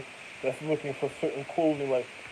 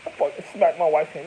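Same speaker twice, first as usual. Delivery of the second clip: normal talking voice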